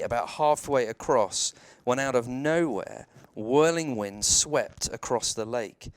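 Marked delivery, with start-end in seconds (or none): none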